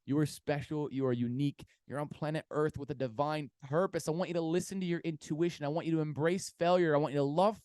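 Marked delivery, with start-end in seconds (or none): none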